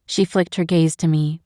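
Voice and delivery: ordinary delivery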